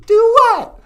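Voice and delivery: very high, loud puppet cooing. Pretend. Puppet Natasha playing the Labyrinth bit, very high. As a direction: coo it as the puppet.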